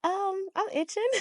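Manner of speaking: high-pitched, hesitant comic reply; sheepish, taken aback. Retold or imitated. Customer answering the blunt pharmacy lady, sheepish and high. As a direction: squeak it out uncertainly, on the edge of laughter. Retells her startled answer at counter.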